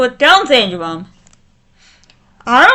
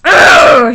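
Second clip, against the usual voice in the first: angry groan; 3 semitones lower, 5 dB louder than usual. Real, not acted. Venting rage at the couple.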